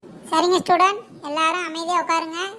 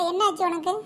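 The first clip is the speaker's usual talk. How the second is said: normal talking voice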